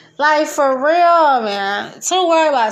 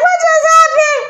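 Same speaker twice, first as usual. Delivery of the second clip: mock shock; 14 semitones higher, 6 dB louder than usual. Voicing beaten rivals, high loud mock disbelief. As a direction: play the stunned loser, pitched way up. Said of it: "They be like".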